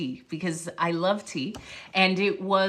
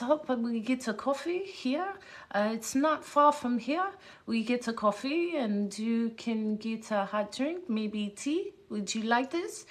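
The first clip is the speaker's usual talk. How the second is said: put-on softer, slightly higher Eastern European accent; [name]'s Eastern European accent; 3 semitones higher, 5 dB quieter than usual. Scientist suggests a coffee nearby, imitated Eastern European accent. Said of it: "this is why I was doing my accent".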